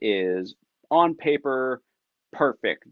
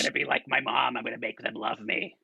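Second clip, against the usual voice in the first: movie villain voice. Retold or imitated. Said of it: "You sounded like a villain from a movie".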